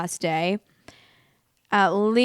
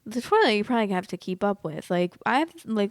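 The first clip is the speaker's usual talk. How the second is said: ordinary talk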